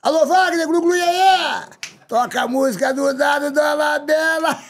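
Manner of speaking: crowd-rousing host shout calling on Wagner to play the song; hammed-up TV host shout. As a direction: bellow it like an old TV auditorium host.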